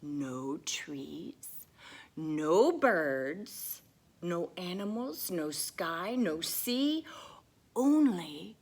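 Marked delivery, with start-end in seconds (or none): none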